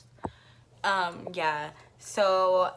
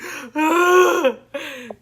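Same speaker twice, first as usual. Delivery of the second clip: loud high sharp gasp; shocked gasp; 9 semitones higher, 13 dB louder than usual. Retold or imitated. Seeing her chipped tooth on his phone, a loud gasp. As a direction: suck in air in horror.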